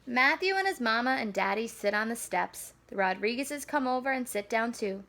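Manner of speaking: usual speaking voice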